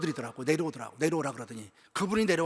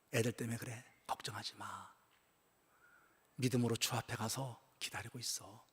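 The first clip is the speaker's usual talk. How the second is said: hushed comfort; 8 semitones lower, 12 dB quieter than usual. Retold or imitated. Pastor comforting dying deacon.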